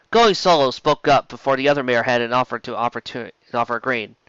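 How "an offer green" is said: ordinary delivery, nothing marked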